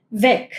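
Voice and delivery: weg with a dry final K sound; German weg. Shown as a demonstration. Teacher modelling German weg. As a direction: say weg ending in a crisp K. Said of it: "Acá esto suena como una K".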